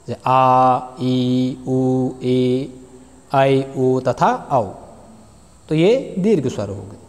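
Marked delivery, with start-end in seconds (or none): 0.1-4.6 drawn-out vowel sounds for the class; long vowels demo. Shown as a demonstration. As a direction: stretch आ ई उ ए ऐ ओ औ slowly, almost sung. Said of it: "जिनके उच्चारण में अधिक समय लगता है".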